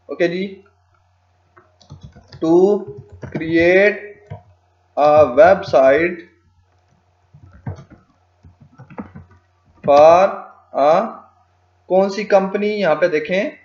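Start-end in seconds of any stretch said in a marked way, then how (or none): 2.4-10.9 stretched words with long pauses; slow dictation. Shown as a demonstration. Tutor typing what the client wants built, slow dictation. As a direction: speak only as fast as you type.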